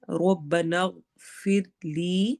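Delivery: ربنا with a full, heavy ra, said slowly. Shown as a demonstration. Teacher shows the heavy ra in ربنا to her students, slowly. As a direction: round the ra of ربنا and take it slowly. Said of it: "را کو موٹا کرنا ہے".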